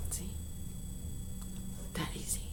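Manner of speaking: soft unvoiced whisper; hushed teacher aside. Real, not acted. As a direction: confide it in a whisper.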